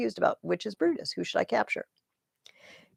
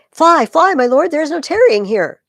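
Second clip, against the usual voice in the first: high, loud cry to flee; urgent shout; 10 semitones higher, 17 dB louder than usual. Acted. Clitus to Brutus, shouting at him to run. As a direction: yell a warning over the battle.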